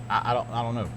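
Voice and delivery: usual speaking voice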